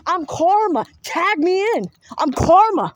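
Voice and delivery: soul pleading with ancestors to be tagged in, shouting; insistent shout. Retold or imitated. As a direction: shout it like you're begging to step in. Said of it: "I'm like".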